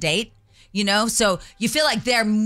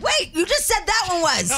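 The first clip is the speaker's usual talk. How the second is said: mock disbelief; 9 semitones higher than usual. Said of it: "We're like".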